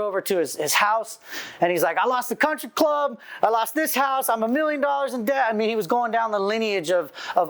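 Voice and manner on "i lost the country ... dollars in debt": higher-pitched, mildly distressed listing; understated retold anguish, pitched up; retold tearful rundown. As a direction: imitate a man listing his losses in despair. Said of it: "tears streaming down his face".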